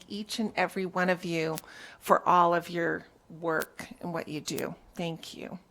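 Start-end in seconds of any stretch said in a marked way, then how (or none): none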